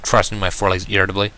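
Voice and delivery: plain reading voice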